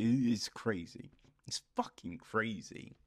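ordinary talk

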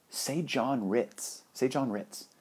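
hushed retold aside; imitated whisper. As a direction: murmur it softly and slowly, as a secret. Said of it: "whispers to me".

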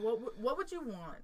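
ordinary delivery

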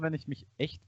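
usual speaking voice

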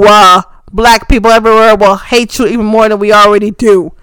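loud, heated rant at the boss; angry shout. Real, not acted. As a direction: yell the demand and pound each no. Angry plea to Vince McMahon.

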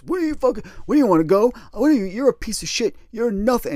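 retold screaming. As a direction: imitate him flipping out, fast and loud. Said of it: "he starts screaming at me".

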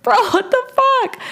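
high-pitched retold disbelief; incredulous outburst. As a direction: exclaim it way up high, baffled. Retelling her own outraged reaction.

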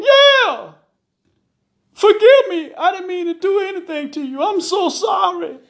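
high-pitched wailing plea, loud at first; tearful pleading. A narrator's reading. Husband in tears begs his wife, pleading. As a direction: beg for forgiveness, wailing.

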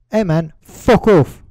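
slow, relishing mock blast; blared out. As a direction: belt it out at a mate. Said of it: "blare it out".

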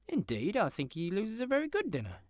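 high, loud cutting-in; eager interruption. Acted. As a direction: jump in over him. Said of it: "interrupted Scrooge's niece".

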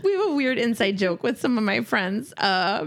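normal talking voice

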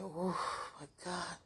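overwhelmed hush. Real, not acted. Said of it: "I gotta calm down".

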